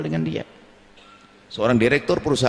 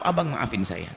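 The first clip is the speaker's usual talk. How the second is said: much softer, humble apology to a husband; 6 semitones lower, 6 dB quieter than usual. Acted. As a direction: say sorry gently. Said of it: "Bertutur kata yang lembut". (minta maaf pada suami dengan lembut dan pelan)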